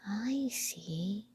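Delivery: soft whispered sigh; hushed sigh. Acted. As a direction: breathe it out in wonder. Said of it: "sighed Mortimer".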